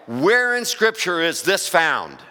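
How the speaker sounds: normal talking voice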